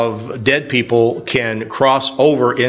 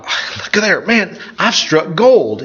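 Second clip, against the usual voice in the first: higher, drawn-out delight on the find; gleeful discovery; 7 semitones higher than usual. Retold or imitated. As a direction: marvel at a lucky haul. Retelling his delight at found candy.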